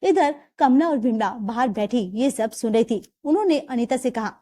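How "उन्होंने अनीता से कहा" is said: usual speaking voice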